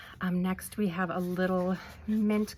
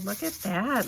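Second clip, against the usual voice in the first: usual speaking voice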